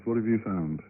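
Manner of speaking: ordinary talk, nothing marked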